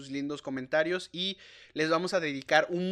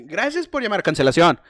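loud, stiff phone greeting; call center operator voice; 12 dB louder than usual. Retold or imitated. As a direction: answer like a bored call center agent.

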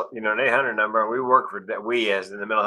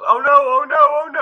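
much higher, louder mock panic; panicked woman; 10 dB louder than usual. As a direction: squeal it like she just realised. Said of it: "and she goes".

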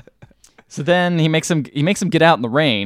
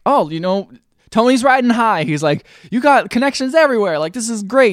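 higher, louder, gushing retelling; excited; 8 semitones higher, 3 dB louder than usual. Retold or imitated. Tony gushing to Doc about his connections, excited. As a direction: hype up his friend's connections. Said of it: "and he's like".

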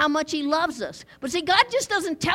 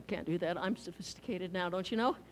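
mock snooty; 9 semitones lower, 10 dB quieter than usual. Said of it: "mocking sophisticated churchgoers".

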